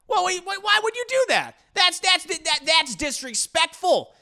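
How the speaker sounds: high, indignant squeal; whiny protest. Acted. Dio objecting to the poison test, high and whiny. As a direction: protest in a high offended whine.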